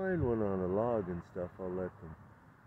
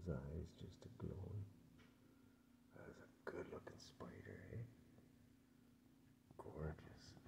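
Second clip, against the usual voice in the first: soft hushed whisper, softer; admiring whisper; 2 semitones lower, 16 dB quieter than usual. Real, not acted. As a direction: whisper so as not to scare it. Whispering close to spider.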